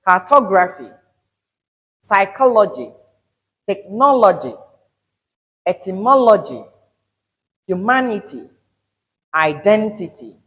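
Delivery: "cartography", "psychology", "technology" and the rest with loud third-last syllable; third-from-end stress. Shown as a demonstration. Teacher drills the word list, stressed syllables loud. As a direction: punch TO, CHO, NO, MO, MA, DEN, VER in turn. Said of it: "pay attention to the stress syllables".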